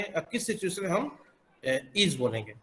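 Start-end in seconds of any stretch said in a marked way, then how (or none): none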